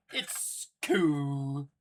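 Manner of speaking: lightly put-on [name] voice; a hint of [name], voice only slightly changed; [name] impression. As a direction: toss off a quick half-hearted [name] imitation. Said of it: "That's my best [name] impression I got".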